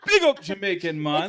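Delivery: high, loud Jamaican patois call. Pretend. Showing off his Jamaican patois, loud and high. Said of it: "a little patois".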